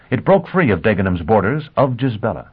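ordinary delivery

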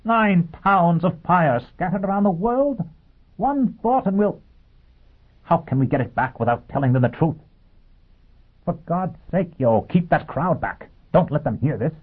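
high-pitched urgent dismay; appalled, strained outburst; frantic alarm. Acted. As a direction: panic and plead, pitched well up. Dagenham panics at Foyle's act.

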